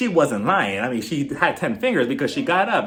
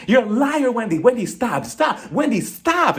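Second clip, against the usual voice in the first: mild mock yell, pitched higher; imitating her screaming at Wendy; half-hearted retold shriek; 6 semitones higher than usual. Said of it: "she was screaming so loud".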